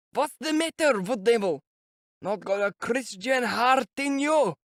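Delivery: put-on high, reproachful old man's cry; old man calling out. A narrator's reading. An old man rebukes Mikolka from the crowd, reproachful cry. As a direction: shame the carter like an outraged old peasant. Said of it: "an old man called from the crowd".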